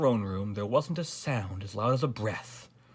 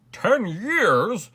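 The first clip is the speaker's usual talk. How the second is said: high, loud shocked question; aghast gasp; 10 semitones higher, 9 dB louder than usual. A narrator's reading. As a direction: gasp it back in disbelief. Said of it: "gasped the king".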